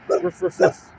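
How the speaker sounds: high squeaky yips; high dog yips. Pretend. Vlogger yips at the dog, very high. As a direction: yip like a little dog.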